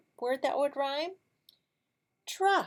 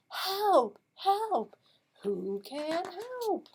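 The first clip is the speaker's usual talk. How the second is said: duck calling for help; 10 semitones higher, 3 dB louder, much slower than usual. Acted.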